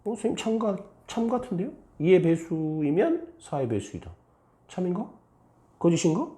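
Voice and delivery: high-pitched puzzled pupil voice; high, hesitant student questioning; unsure student. Acted. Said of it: "어떤 사람은 참이라 하고 어떤 사람은 거짓이라고".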